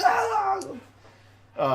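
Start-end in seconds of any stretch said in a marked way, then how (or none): 0.0-0.6 pained cry. Acted. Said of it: "He's like".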